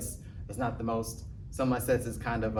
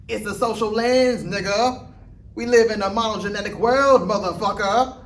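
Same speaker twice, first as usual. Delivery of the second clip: high, loud parody voice; exaggerated mock lecture, pitched way up; impression of his impersonators; 10 semitones higher, 7 dB louder than usual. Comic imitating people who imitate him, loud hammed-up impression. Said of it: "impressions of people doing impressions of me".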